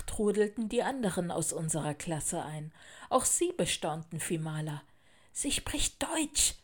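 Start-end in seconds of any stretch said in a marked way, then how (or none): 5.3-6.5 high, slow warning. A narrator's reading. Girl warns classmates about the newcomer, warning. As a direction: warn them in a drawn-out, hushed-up tone. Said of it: "warnte ich die anderen".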